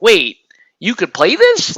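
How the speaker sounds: mock astonished, pitch lifting at the end; going nuts. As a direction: imitate a thrilled comic book fan's disbelief. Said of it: "comic book people always go nuts".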